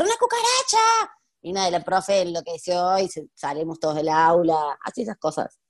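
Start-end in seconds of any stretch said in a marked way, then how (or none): 0.0-1.1 high-pitched re-enacted cry of alarm; mock scream. Student pranking a teacher in class, mock scream. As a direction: shout it high, imitating your prank scream. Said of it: "empecé a gritar".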